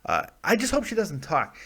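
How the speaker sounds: ordinary talk, nothing marked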